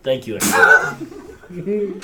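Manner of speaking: put-on slow, heavy Russian accent; a Russian warlord speaking in a Russian accent. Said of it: "you think I wasn't gonna use a Russian accent?".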